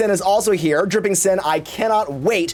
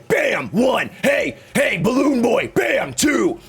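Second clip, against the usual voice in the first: higher, mock hype shout; gym bro shouting; 5 semitones higher, slower than usual. Bro buddy mock-fighting in the gym parking lot, hyped shout. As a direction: bro yelling punches at the rival. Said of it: "a friend to come up and be like".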